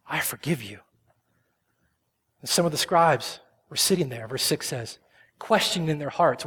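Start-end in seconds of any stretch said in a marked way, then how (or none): 0.1-0.8 soft, breathy whisper; whispered forgiveness. Pretend. Jesus to the paralyzed man, whispered. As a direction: hush it like a secret blessing.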